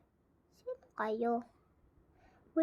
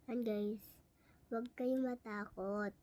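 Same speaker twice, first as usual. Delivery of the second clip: ordinary delivery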